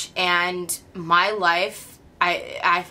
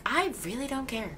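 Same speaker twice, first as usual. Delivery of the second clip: indifferent; 4 semitones higher, 10 dB quieter than usual. Retold or imitated. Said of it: "days that I'm like".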